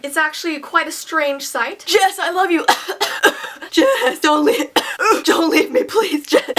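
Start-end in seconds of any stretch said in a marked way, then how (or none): 1.9-6.5 put-on a sobbing boy chokes on his first cigarette; coughing, crying boyfriend. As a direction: beg between coughing fits.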